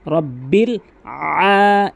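আ drawn out with weight; slow recitation with a heavy long আ; stressed long a. Shown as a demonstration. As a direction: lean on the আ of রব্বিল আ- and hold it. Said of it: "এভাবে চাপ দিয়ে ভর দিয়ে".

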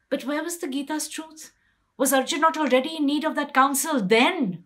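high, loud, fast rising challenge; bitter accusation. Acted. Draupadi challenging Krishna's counsel, rising loud and bitter. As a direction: fling the question out, building in anger.

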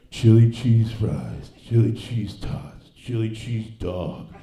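very slow, loud, dazed menu reading; loud, dragged-out stoned ordering. Retold or imitated. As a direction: read each item like you're barely there. Stoned man reading menu aloud.

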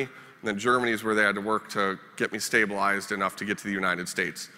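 ordinary talk, nothing marked

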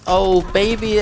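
excited whoop. Real, not acted. Excited kill reaction.

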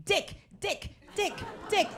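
high, soft staccato chant; bouncing little boy. Retold or imitated. Imitating five-year-old son jumping on bed, gleeful chant. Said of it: "he was jumping on the bed".

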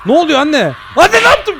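sudden high, loud cry of shock; shocked yelp. Real, not acted. Boy yelling at his mother after a sudden slap, shocked. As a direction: yell out, caught off guard by the slap. Slapped by mom in game.

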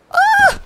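sudden very high shriek. Real, not acted. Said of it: "Sorry for yelling like a little girl".

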